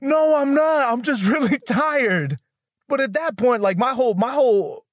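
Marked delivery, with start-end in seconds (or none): 0.0-2.4 groggy denial. Retold or imitated. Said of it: "He says".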